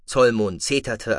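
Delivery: ordinary delivery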